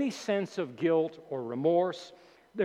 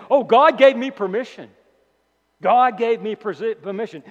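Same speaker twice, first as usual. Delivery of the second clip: loud, higher-pitched mock protest; false teacher's claim; 5 semitones higher, 9 dB louder than usual. Preacher voicing false teachers claiming permission, loud mock protest. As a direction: exclaim it like a self-excusing teacher. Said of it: "Again, saying".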